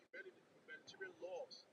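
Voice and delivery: talking away from the phone; phone held away. Real, not acted. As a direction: voice off-mic, distant from the phone. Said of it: "You're far away from the phone again".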